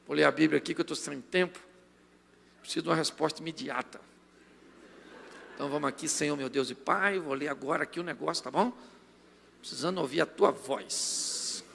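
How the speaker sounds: a hint of a quiet prayer; softly, rushing the Bible reading; mock quiet hurried reader. Imitando leitura apressada. (imitação leve e baixa de leitura apressada)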